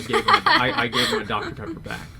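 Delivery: high-pitched, breaking into laughter; laughing. Real, not acted. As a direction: laugh through the confession. Laughing while admitting the swap.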